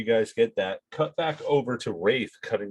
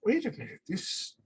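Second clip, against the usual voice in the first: suspicious; 2 semitones higher, 4 dB quieter than usual. Acted.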